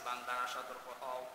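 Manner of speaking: low, soft warning to the servants; quiet warning. Real, not acted. Preacher reminds the servants to beware, low and hushed. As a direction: drop the voice for the warning.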